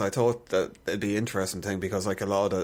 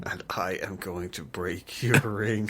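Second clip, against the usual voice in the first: slow, menacing whisper; villain whisper; slower than usual. Pretend. Joking villain threat.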